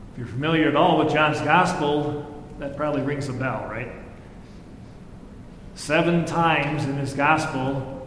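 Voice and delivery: ordinary talk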